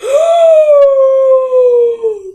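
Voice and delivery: very high, loud startled gasp; sharp gasp. Real, not acted. Vlogger spotting a new book, loud startled gasp. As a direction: suck in air like the news just hit.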